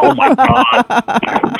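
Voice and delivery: high, very slow oh my God; awestruck. Retold or imitated. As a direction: stretch it out in disbelief. Retells finding rare tape.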